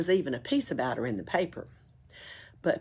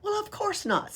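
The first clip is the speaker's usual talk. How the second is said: cheeky high reply. Acted.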